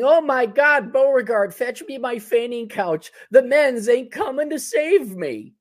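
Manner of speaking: high, slow, melodramatic swoon; swooning southern belle. Retold or imitated. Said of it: "fake feigning".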